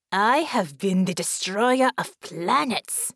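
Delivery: loud, slow villain's roar; grandiose, booming alien menace; roared boast. A narrator's reading. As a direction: roar it like a conquering warlord. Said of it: "Baron roared".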